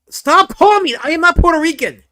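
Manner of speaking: loud, high-pitched indignant outburst; indignant comic shouting; mock outrage. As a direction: yell a hammed-up protest. Mock outburst at being called Puerto Rican.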